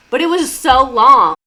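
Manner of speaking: "so long" loud and drawn out; exasperated emphasis. Real, not acted. Exasperated at how long it went.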